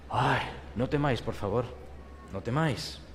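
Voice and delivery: low, soft and tender; soothing plea. Acted. Angel pleading with the terrified shepherds, soft and low. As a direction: coax them out of their fear.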